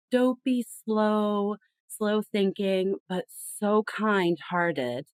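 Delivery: slow, stretched-out words. Shown as a demonstration. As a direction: linger on each word to illustrate slowness. Illustrates the slow Southern stereotype.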